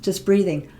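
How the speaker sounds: long audible sigh; just breathe out through the throat; airy exhale; breathy sigh demo. Shown as a demonstration. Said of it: "we can make a whispery sound".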